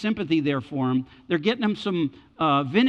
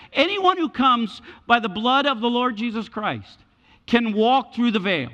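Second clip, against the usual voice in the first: high, raised preacher's declaration; divine proclamation; 8 semitones higher, 5 dB louder than usual. Acted. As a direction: proclaim an open invitation to all. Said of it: "opens up the way and says".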